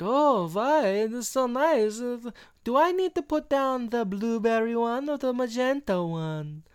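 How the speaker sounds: high, exaggerated fake French accent; hammed-up French accent in a high voice; [name]. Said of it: "he's doing a very fake French accent".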